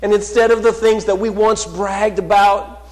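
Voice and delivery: loud, high, rising sermon voice, slowing on key words; fervent pulpit proclamation; full-force preaching. Acted. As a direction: preach it out to the whole church. Preaching promise of victory.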